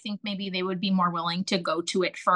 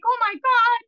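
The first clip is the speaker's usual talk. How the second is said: 1 tearful panic; 12 semitones higher than usual. Retold or imitated. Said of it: "Then I start crying".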